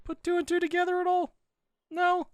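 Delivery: high squeaky disbelief; high sarcastic squeal of disbelief; mock disbelief. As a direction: act stunned the character missed it.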